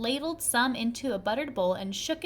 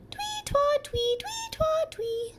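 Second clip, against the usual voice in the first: much higher sung whistle twee twa; whistle imitated; slower than usual. A narrator's reading. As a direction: chirp it like a whistle.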